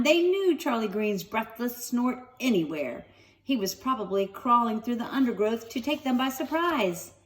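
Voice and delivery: plain reading voice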